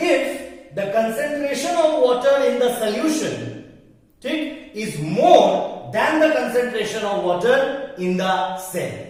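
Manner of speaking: slow, word by word, spelling out the definition; teacher dictating a definition to students, very slow and deliberate; very slowly. Shown as a demonstration. Repeating the rule for students, slowly word by word. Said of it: "बहुत धीरे धीरे बोल रहा हूं मैं".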